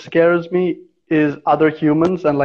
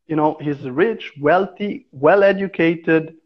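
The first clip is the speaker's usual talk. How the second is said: ordinary talk, nothing marked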